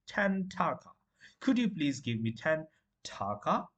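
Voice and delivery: normal talking voice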